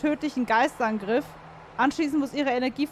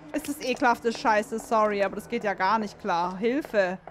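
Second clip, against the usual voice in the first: normal talking voice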